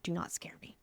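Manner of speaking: hushed, very soft retold warning; whispered warning. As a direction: drop to a whisper to warn him. Warning husband in retold scene.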